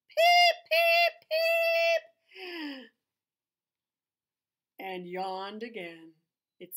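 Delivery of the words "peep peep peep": very high, loud little beeps; squeaky duckling peeps. Acted. Reading to a child, duckling peeping squeakily. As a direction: peep like a tiny duckling.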